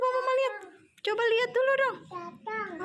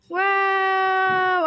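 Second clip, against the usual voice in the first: excited wow; 7 dB louder than usual. Real, not acted. Opening the package with child.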